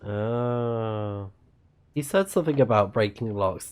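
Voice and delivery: normal talking voice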